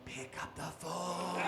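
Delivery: low, soft slow ghost. Pretend. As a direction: moan it down the phone. Ghost on voicemail.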